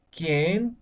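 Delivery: QUIÉN lifted at the end as a question; rising question intonation. Shown as a demonstration. Teacher shows how Castilian questions are intoned, rising tone. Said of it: "entonamos al, al terminar".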